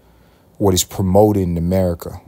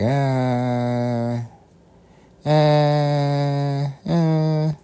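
lazy groan; 8 semitones higher, much slower than usual. Acted.

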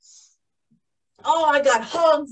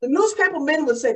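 usual speaking voice

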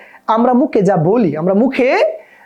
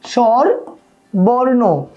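স্বরবর্ণ drawn out very slowly, as the answer to a question; slow class answer; much slower than usual. Shown as a demonstration. Teacher drags out the answer for students to echo, slowly. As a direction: stretch out স্বরবর্ণ for the class to repeat. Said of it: "কি বলো?".

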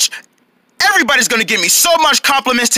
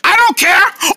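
high-pitched shouted refusal; defiant yelling; 6 semitones higher, slower than usual. Acted. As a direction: yell back stubbornly. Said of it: "Stop yelling!".

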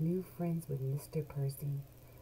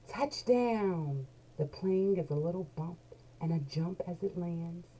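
plain reading voice

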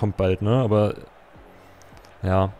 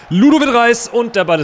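excited shout; 12 semitones higher, 8 dB louder than usual. Real, not acted. Near goal, excited call.

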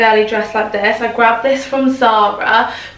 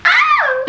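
a sudden squeal far up in pitch; high delighted shriek. Real, not acted. Unpacking for a yacht trip, sudden high squeal of delight. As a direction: yelp with glee.

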